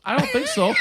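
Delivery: high, loud snippy retort; sassy refusal. Retold or imitated. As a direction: shut him down with attitude. Mail carrier refusing him.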